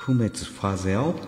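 不滅ファゼオ said with a mock French lilt; fake French pronunciation. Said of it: "フランス語っぽく言うと？".

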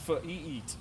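"f-, e-, eat" sound by sound. Shown as a demonstration. Told to sound it out, "f-, e-, eat" piece by piece. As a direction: break "f-, e-, eat" into separate sounds. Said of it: "Come on, sound it out".

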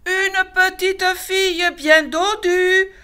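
high, loud and slow, savouring it; hungry cubs crying out in chorus; cried out in chorus. A narrator's reading. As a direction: shout it greedily together. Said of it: "s'écrièrent tous en chœur".

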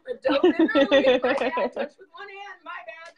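high, laughing mock confession; laughing excuse. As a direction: laugh through a sheepish excuse. Acting out her own excuse.

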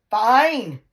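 high loud icy fury; wife warning him about going out for pints; furious fine. Retold or imitated. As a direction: snap it out, dead serious. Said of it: "If you say to your woman".

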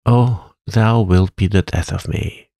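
plain reading voice

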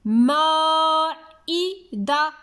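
مائدة as long held maa, then ii, da, tun, each apart; teacher's slow breakdown of مائدة, the maa sustained; syllable by syllable. Shown as a demonstration. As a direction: sound out مائدة slowly with pauses between syllables. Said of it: "لاحظوا معي".

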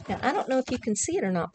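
ordinary delivery

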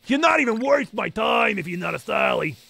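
slow, drawn-out disdainful put-on voice; a hint of a tough-guy sneer; lightly voiced snob. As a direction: dismiss the Pokemon like a haughty boss. Voicing a snooty dismissal of non-Starly.

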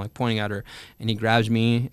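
ordinary delivery

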